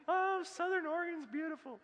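high, dreamy mock tourist voice; leisurely Sunday driver. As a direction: float it like a carefree driver admiring the view. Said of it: "they're just on a Sunday drive".

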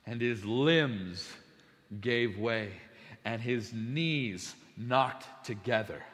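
slow, low, hushed verse reading; solemn scripture reading. Acted. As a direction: read the verse gravely, phrase by phrase with long pauses. Reads scripture aloud solemnly.

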